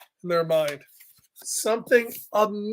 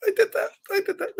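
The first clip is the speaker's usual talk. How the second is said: high, proud, overjoyed cry; proud exclaim; 11 semitones higher, 5 dB quieter, faster than usual. Retold or imitated. Reader voicing Taylor proud of the build. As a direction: burst with pride like a kid. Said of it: "you're like".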